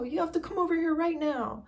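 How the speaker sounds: high urgent pleading voice, pitched well up; frantic friend. Retold or imitated. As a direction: act out a panicked friend summoning her. Friend calling in panic, voiced.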